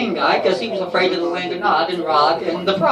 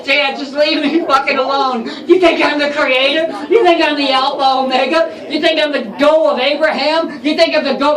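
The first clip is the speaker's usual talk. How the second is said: louder, raised, sarcastic brush-off; God scoffing at a pestering fan; annoyed God talking shit; 4 semitones higher, 3 dB louder than usual. Acted. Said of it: "he's gonna start talking shit".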